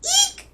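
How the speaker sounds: very high, loud storybook eek; short frightened squeal; shriek. A narrator's reading. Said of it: "she shrieked".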